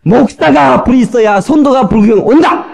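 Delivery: "목사가 불이 있어야 성도가 불구경 온다" high, loud and slow; proclaimed loudly. Shown as a demonstration. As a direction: proclaim it slowly so the crowd can answer. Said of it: "다시 시작했어".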